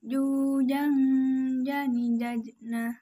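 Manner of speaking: sustain, don't rush; held voice. Shown as a demonstration. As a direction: hold "jang" and "jani" on the nasal. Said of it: "tahan suaranya".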